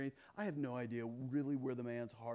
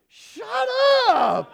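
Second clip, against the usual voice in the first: much higher, loud squealed shut up; shrieking disbelief; 19 dB louder than usual. Retold or imitated. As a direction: squeal it like she can't believe it. She goes.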